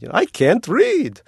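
put-on high, slow Russian accent; lightly put-on Russian accent. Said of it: "I could do it in a Russian accent".

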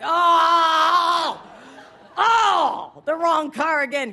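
high, loud groan of dismay; mock dismay. Hearing the subway speech start, loud mock dismay. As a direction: yelp like you picked the wrong car. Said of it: "I'm like".

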